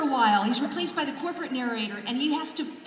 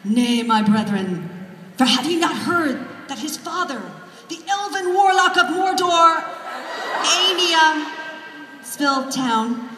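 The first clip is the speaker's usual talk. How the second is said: mock-archaic grand prose; 4 semitones higher, 7 dB louder than usual. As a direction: ham up the thee-and-thou epic, self-mocking. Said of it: "I would write sentences like".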